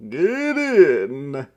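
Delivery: loud, high, gleeful call; exuberant cry. A narrator's reading. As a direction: shout it with delight. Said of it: "cried the driver exuberantly".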